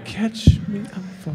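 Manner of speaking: high squealing fake panic; mock damsel in distress. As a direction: play helpless, way up high. Mocking Emily's fear of falling.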